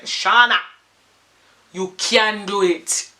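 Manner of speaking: loud, slow Jamaican mum; Jamaican accent. Retold or imitated. Mum tells her doubting daughter firmly, loud Jamaican accent. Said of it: "She's Jamaican".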